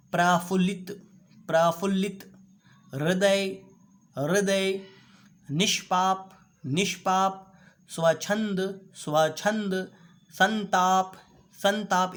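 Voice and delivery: each word said twice, loud and distinct; model pronunciation drill. Shown as a demonstration. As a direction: drill every word clearly for students to repeat. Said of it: "एक एक करके आप बोलिए". (हर शब्द को दो बार, ज़ोर से और साफ़ बोलना)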